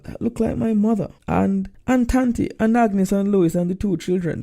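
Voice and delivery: a son amazed to see his whole family arrive; consternation. Acted. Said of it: "Tolroy, in consternation".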